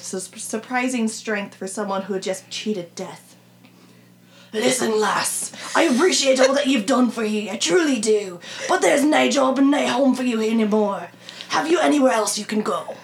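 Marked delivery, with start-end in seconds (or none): 4.5-13.0 put-on a sincere Scottish voice for the old man Mac; Scottish accent. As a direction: read the gruff game master's Scottish line. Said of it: "A little taste of the Scottish accent?".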